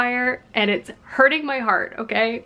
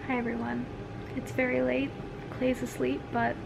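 quiet; 2 semitones higher, 10 dB quieter than usual. Real, not acted. Late night, partner asleep.

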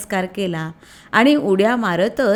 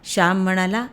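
plain reading voice

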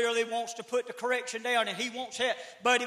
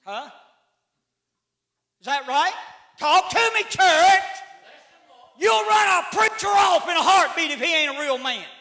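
ordinary talk, nothing marked

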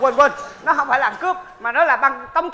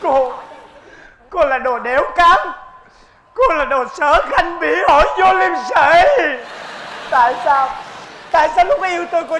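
outraged shout; 3 semitones higher, 4 dB louder than usual. Acted. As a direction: hurl every insult at her. Blackmail victim shouting at woman. (la hét phẫn nộ, chửi liên tục)

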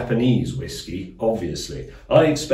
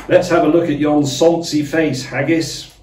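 mock Burns address; 5 semitones higher, 5 dB louder than usual.

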